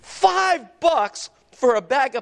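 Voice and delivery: sudden high, indignant what; shocked outrage. Pretend. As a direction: act out a shocked shopper at the price. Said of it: "You're, you're like".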